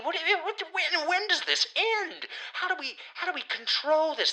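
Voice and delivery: high, rising panicked rant; mock panic. Ranting to listeners about radioactive spiders, in rising mock panic. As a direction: wind yourself up into shrill mock alarm.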